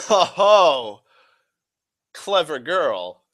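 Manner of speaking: loud, high laughing "oh", then drawn-out praise; hammed-up knowing admiration, slowing down; playfully impressed. Speaker catching someone's trick, playfully impressed.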